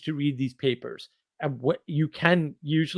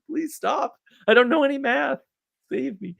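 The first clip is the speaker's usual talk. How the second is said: much higher, whiny plea; pleading mock despair; 12 semitones higher than usual. Speaker playing himself begging the buddy to drop the math, high whiny plea. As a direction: beg to be spared like a drama queen. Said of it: "He says".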